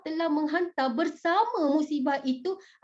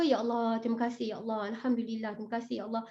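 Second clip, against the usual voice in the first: softer, lower, calm thanks; grateful prayer; 4 semitones lower, 6 dB quieter, faster than usual. Shown as a demonstration. Teacher shows how to answer any hardship, soft low grateful prayer. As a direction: give thanks quietly and acceptingly. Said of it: "jadi bila cakap".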